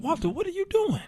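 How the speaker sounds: high, rising scolding of himself; inner voice urging. Retold or imitated. Boldness speaking to him in the elevator, high urging. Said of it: "He said".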